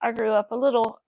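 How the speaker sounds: ordinary talk, nothing marked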